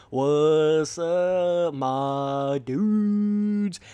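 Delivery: hugely drawn-out put-on greeting; over-the-top slow YouTuber catchphrase; signature goofy intro. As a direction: stretch every word into a long, held intro call. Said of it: "I'm never gonna stop doing my intros".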